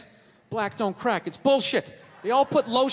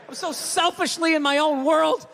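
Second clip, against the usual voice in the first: usual speaking voice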